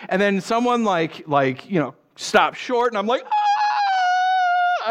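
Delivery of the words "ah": long, very high scream; re-enacted scream. Retelling back-seat scream when scooter stops short, long high scream. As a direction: scream in sudden comic terror. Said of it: "I actually screamed in the back seat".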